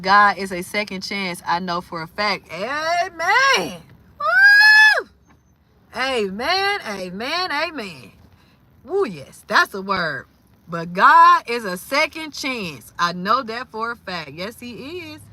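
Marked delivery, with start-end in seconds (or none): 2.5-9.1 high whooping amen; excited amen. Real, not acted. Hyping up her chat about God, whooping. Reacting to own faith statement. 10.7-12.9 louder, slower, leaning on God; emphatic testimony. Real, not acted. Repeating her testimony to viewers, slower and louder.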